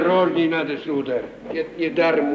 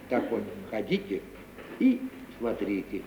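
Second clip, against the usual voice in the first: much softer and lower, hushed advice; confidential; 8 semitones lower, 11 dB quieter than usual. Acted. Servant giving the young man sly, hushed advice. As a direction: lean in and share a secret.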